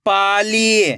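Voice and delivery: Pali joined as one word with long ई; blended word. Shown as a demonstration. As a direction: read Pali whole, falling at the end. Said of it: "खींच के पढ़ना है".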